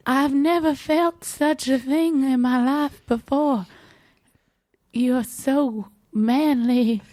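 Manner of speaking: high-pitched swooning heroine; Miranda. A narrator's reading. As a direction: gush in a high damsel voice, slowing at the end. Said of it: "she exclaimed".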